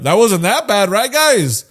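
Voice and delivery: loud, high-pitched mock tactless sidekick; stupid comic relief. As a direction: play the dumb sidekick chiming in too brightly. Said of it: "some fucking stupid ass being like".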